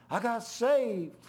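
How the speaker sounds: put-on high, loud, slow child's voice; high-pitched kid blurting his news; shy boy's proud news. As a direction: voice the boy finally piping up. Shy grandson's announcement voiced.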